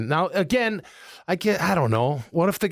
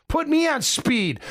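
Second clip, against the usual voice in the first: mock demand; 9 semitones higher than usual. Mock demand about amphetamines.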